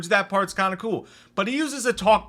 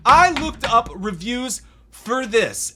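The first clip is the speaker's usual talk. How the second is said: slow, high, loud, word by word; slow emphatic repeat; 7 semitones higher, 5 dB louder, slower than usual. Real, not acted. Reviewer appalled by having read reviews, slow emphatic repeat. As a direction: spell out each word in disbelief.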